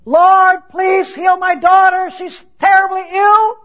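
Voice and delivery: high, loud, drawn-out plea; crying out. Acted. Canaanite woman pleading for her sick daughter, crying out. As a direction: call out desperately after someone walking away. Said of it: "she's crying out after Jesus".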